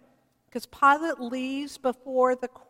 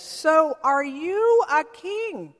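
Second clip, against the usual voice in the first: Pilate's question; 5 semitones higher, 8 dB louder, slower than usual. Retold or imitated. Pilate questions Jesus in retelling.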